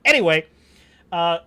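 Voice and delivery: ordinary talk, nothing marked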